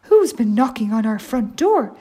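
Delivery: lightly voiced mama bear, loud; a loud question with a hint of mother bear; medium-sized voice. Mama bear finds a stranger at the door, medium-sized voice. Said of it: "said Mama Bear in a medium-sized voice".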